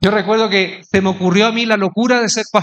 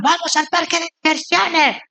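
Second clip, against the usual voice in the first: excited youngsters; 7 semitones higher than usual. Retold or imitated. Youngsters pitching an outing to their pastor, high and eager. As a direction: imitate kids clamouring in a high voice. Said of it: "los muchachos dijeron".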